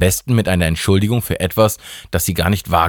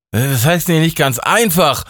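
raised, higher indignant question; getting loud; 9 semitones higher than usual. Acted. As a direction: demand an answer, rising up. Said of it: "wurde Steffi laut". (empört und lauter)

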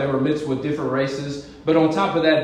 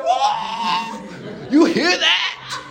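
high, slow, laughing brag; gleeful, laughing it out; laughing excitement; 12 semitones higher, much slower than usual. Retold or imitated. Preacher bragging to his wife, high and laughing. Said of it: "I said".

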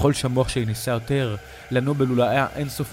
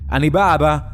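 loud, higher shout to Dad; urgent raised-voice call; 4 semitones higher, 7 dB louder than usual. A narrator's reading. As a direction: holler that help is coming. Son calls to his father.

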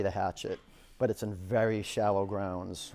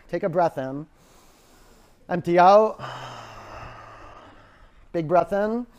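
breathing cues; 5 semitones higher, 9 dB louder than usual. Acted. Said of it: "breathing cues in class".

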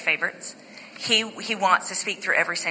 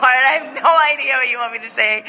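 pleading; 5 semitones higher, 6 dB louder than usual. Retold or imitated. Retold prayer, unsure.

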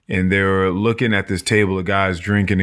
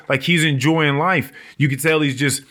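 ordinary talk

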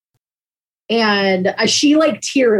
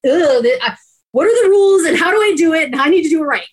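high, fast, exasperated rush of questions; exasperated outburst; 10 semitones higher, 5 dB louder, faster than usual. Retold or imitated. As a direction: spill the panic about doing it wrong, pitched way up. Retelling her frustration in therapy.

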